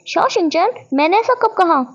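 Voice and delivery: shocked denial. Acted. Wangji denies Shao's claim, shocked and loud. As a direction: blurt it out caught off guard.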